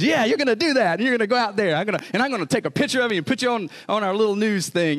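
high, eager, insistent; hyped-up retold assurance; excited missionary. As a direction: imitate an enthusiast talking someone into it. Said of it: "he got you all excited when he talked to you".

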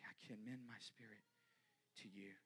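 dying breath. Acted. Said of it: "With the last breath".

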